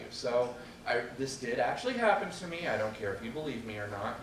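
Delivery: ordinary delivery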